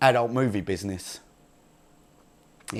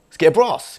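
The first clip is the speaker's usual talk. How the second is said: excited push; 7 semitones higher, 9 dB louder than usual. Retold or imitated. Pushing dad for Christmas brass.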